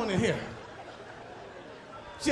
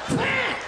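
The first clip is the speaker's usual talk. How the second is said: high, shouted bam blast; a sudden explosion yelled out; loud bam; 12 semitones higher, 3 dB louder than usual. Retold or imitated. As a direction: slam the word out like a blast. Mimics violent toilet blast.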